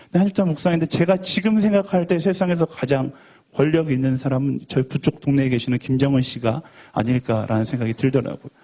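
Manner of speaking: ordinary talk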